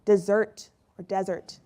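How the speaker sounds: slow "Desert or desert", first DE-sert, then de-SERT; two stresses shown. Shown as a demonstration. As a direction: slowly contrast "Desert" as the noun and "desert" as the verb. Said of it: "Where a xerophyte grows or to abandon?".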